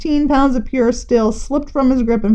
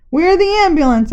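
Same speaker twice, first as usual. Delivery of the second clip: announced; 6 semitones higher than usual. A narrator's reading. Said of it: "announced Kevin".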